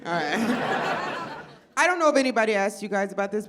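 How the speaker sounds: ordinary talk, nothing marked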